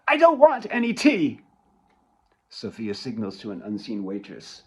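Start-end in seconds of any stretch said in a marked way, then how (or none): 0.1-1.4 loud, high refusal; angry shout. Acted. Daughter snapping at her mother over tea, loud and angry. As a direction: shout the offer down.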